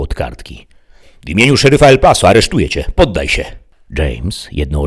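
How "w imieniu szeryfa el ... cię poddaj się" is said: fast, loud, high order; commanding arrest. A narrator's reading. Deputy arrests the outlaw, loud urgent command. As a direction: rush out the arrest loudly.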